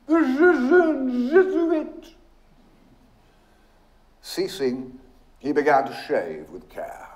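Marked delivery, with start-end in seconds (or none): 0.1-2.1 very high, slow, drawn-out delighted cry; loud, gleeful high-pitched calling. A narrator's reading. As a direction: crow it out slowly in a high voice. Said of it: "laughing with delight, cried".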